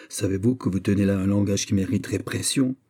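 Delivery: ordinary delivery, nothing marked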